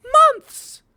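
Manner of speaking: shrieking, high and loud disbelief; incredulous shout. Real, not acted. Ranting to viewers about wasted months, shrieking in disbelief. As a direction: build up and explode on insane and months.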